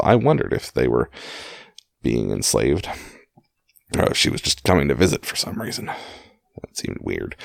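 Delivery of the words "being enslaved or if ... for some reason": plain reading voice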